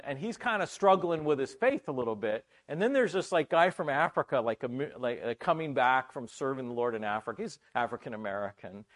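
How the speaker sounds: normal talking voice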